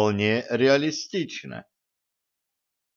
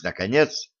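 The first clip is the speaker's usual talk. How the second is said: usual speaking voice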